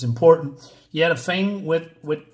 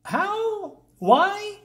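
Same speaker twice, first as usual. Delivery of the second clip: baffled disbelief. Retold or imitated. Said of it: "and I thought".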